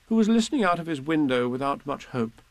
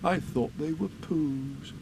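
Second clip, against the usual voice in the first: higher, slow, dreamy bear voice; a touch of a bumbling teddy; slow puzzled Pooh; 4 semitones higher, slower than usual. Acted. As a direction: wonder it out slowly like a bear of little brain.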